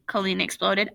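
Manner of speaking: ordinary delivery, nothing marked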